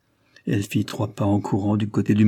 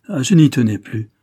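louder, higher burst of relief; emotional outcry; 3 semitones higher, 7 dB louder than usual. Acted. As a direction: let it burst out after long waiting. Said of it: "s'écria-t-elle".

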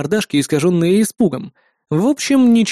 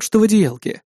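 usual speaking voice

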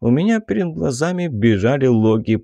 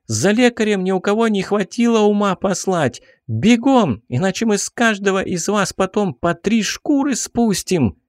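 high angry yell at the crowd; shouting; 9 semitones higher than usual. Acted. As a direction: bark orders at the peasants. Girl keeps yelling at crowd.

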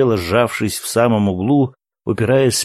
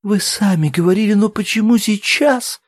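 high, tearful protest; plead, breaking into tears; crying; 12 semitones higher, 4 dB louder than usual. A narrator's reading. Woman asks why they deny her now, starting to cry. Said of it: "Она снова заплакала".

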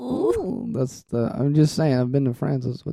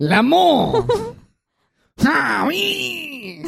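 high, hammed-up French squeal; mock French; 12 semitones higher, much slower than usual. Clowning French accent.

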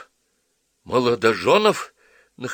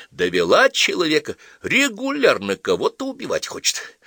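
higher, mock-didactic lecturing; jesuitical preaching tone; 10 semitones higher, 3 dB louder than usual. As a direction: moralise slyly, with fake piety. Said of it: "иезуитски назидательным тоном продолжал Сорняков".

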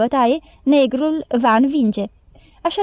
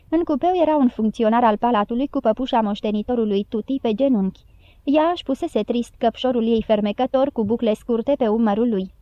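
ordinary delivery, nothing marked

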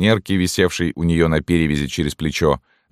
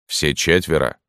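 sergeant questioning. A narrator's reading. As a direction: question her in the sergeant's own-pitched voice. (вопрос сержанта, твёрдым голосом)

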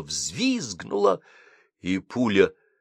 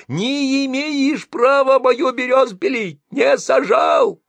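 much higher, slow, loud screaming; yelling; 6 dB louder, slower than usual. Acted. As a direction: scream the grievance. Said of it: "баба орала про берёз".

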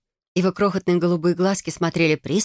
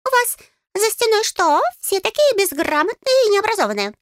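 high, squeaky outrage; indignant little fairy; Gustav, indignant; 3 dB louder than usual. A narrator's reading. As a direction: huff in a tiny piping voice. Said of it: "возмутился Густав".

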